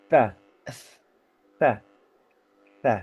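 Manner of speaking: "Ta" as a lisped tsa with the air streaming out; flowing tsa. Shown as a demonstration. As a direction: keep the air flowing on every "Ta". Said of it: "masih mengalir begitu saja".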